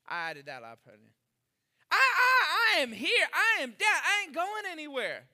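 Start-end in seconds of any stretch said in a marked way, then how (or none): 1.9-5.3 loud, high, eager promise; a zealous disciple shouting his loyalty; fired-up vow. Acted. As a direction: swear it with everything you've got. Peter vowing loyalty to Jesus.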